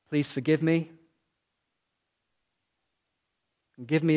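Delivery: slow, spaced prayer with long pauses. Shown as a demonstration. Preacher leading the congregation line by line, slow and hushed. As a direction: pray it line by line, leaving gaps. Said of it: "I'm gonna say it slowly".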